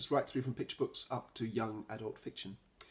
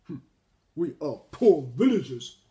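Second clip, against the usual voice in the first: loud put-on grumble; Monkey grumbling; 11 dB louder than usual. A narrator's reading. Monkey turns the traveller away, grumbling. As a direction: grumble it out stingily. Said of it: "Monkey grumbled".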